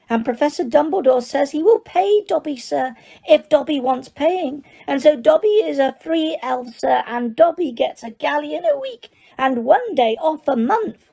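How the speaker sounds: high, squeaky elf voice, eager to please; squeaky house elf. Acted. House elf tells Harry he needs work, high and squeaky. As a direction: pitch it up as an excitable little elf. Dobby continues.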